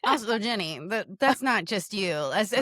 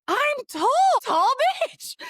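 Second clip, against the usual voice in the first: high, gleeful crow of discovery; triumphant self; 5 dB louder than usual. Retold or imitated. Walking home through New York, a high gleeful mock cheer. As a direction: shout it out proudly. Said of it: "And I was like".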